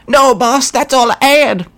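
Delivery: a nervous goblin pleading with the boss; grot voice. Acted.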